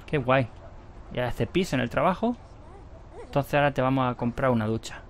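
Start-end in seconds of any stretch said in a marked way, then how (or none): none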